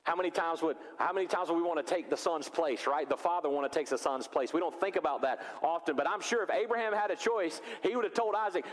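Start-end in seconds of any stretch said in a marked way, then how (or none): none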